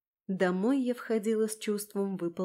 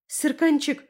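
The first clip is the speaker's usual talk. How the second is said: loud, higher cry of alarm; urgent exclaimed call; exclamation; 6 semitones higher, 6 dB louder than usual. A narrator's reading. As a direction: cry out the pet's name. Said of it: "воскликнула я".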